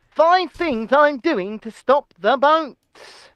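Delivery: slow, weighty political announcement; mock-solemn, every word spaced out; Rishi Sunak. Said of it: "let me do my best Rishi impression".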